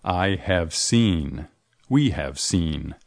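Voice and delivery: each word drawn out slowly; slowly, as a model for learners; teacher modelling slowly; slow model sentence. Shown as a demonstration. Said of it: "participio pasado de see".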